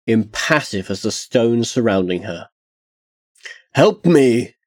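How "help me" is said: screamed plea for help, louder; screaming. A narrator's reading. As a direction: yell up to the cliff. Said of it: "he screamed up at Tarsia".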